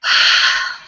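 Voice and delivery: loud, breathy, unvoiced sigh; dramatic sigh. Shown as a demonstration. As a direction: sigh it all out audibly. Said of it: "Big sigh".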